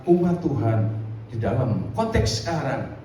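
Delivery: normal talking voice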